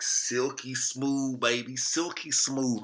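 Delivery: slow, crooning silky smooth; silky sales pitch. Acted. Selling men on smooth skin, slow croon.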